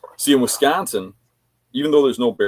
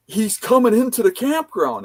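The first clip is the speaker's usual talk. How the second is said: loud, high-pitched imitated lookout; alarmed narrating; 5 dB louder than usual. Hunter warns camp of the truck, imitated alarmed narrating. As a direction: act out a buddy sounding the alert. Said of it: "like, narrating it".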